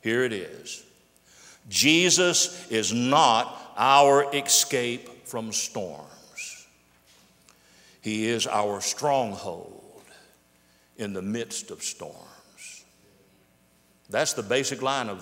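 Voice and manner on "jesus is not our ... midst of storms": very slow, deliberate, with long pauses; slow, for note-takers. Real, not acted. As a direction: say it slowly enough to write down. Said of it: "I'll say it slowly".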